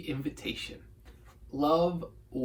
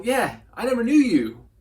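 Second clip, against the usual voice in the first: higher and louder, a curt brush-off; Jesus dismissing; 6 semitones higher, 6 dB louder than usual. Retold or imitated. Jesus turning away the ones who claimed his name, curt. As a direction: wave them away like a stranger. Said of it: "he's gonna go".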